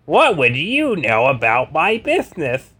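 high, slow, suspicious Carr voice; frowning Carr. Acted. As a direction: frown through the question. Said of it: "Carr frowned".

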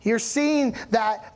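ordinary talk, nothing marked

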